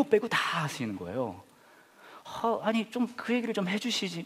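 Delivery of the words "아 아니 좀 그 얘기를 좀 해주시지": frustrated inner whine. Retold or imitated. Retold inner complaint.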